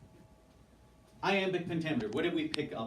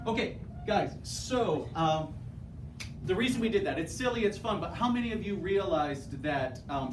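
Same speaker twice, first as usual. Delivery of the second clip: higher, louder upbeat call to the class; energetic teacher; 6 semitones higher, 4 dB louder than usual. Real, not acted. Teacher winding up her class after the activity, excited. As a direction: wake the room up with energy.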